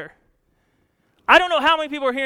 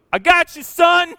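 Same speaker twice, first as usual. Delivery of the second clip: booming reply; 6 semitones higher, 8 dB louder than usual. Acted. God answering Jesus, voiced.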